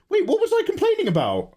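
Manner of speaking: high, playful mock-baffled question; mock confusion. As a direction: feign total innocence. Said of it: "[name], stop complaining".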